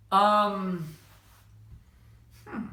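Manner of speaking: loud drawn-out "Um", long silence, then "hmm"; significant pause. Shown as a demonstration. Answering whether you've murdered anybody, "Um", long telling pause, "hmm". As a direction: stall on "Um", leave a long gap, then "hmm". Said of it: "That might be a significant pause".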